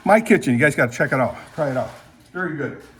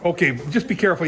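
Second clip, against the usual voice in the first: ordinary talk, nothing marked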